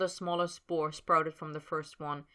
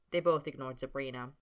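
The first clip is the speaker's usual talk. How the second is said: ordinary delivery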